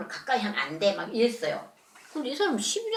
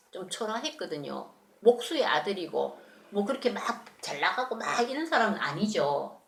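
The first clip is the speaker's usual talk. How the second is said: ordinary talk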